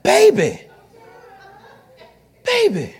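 lightly hammed-up husband; smug husband. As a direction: sweet-talk her proudly. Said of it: "he said".

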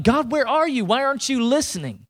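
high-pitched appeal to God; acted exasperated prayer; frustrated plea. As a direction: plead upward as if nobody is listening. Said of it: "When you've been frustrated".

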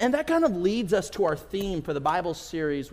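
usual speaking voice

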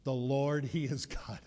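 the people confessing God in a hushed voice; hushed awe. Acted. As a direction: breathe it out in awe. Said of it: "what the people said".

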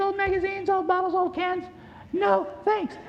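put-on high, bright-eyed child asking at the door; high-pitched kid collecting junk; kid's voice. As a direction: play the eager little kid. Kids collecting trash door to door.

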